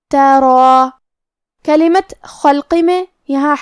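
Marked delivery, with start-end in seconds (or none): 0.1-0.9 ترا with a heavy, full ر and a long alif; tajweed demo. Shown as a demonstration. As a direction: say ترا thick and drawn out. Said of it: "را کو موٹی آواز میں پڑھنا ہے".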